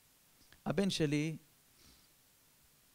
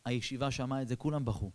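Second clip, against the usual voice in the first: ordinary talk, nothing marked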